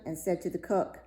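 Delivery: ordinary delivery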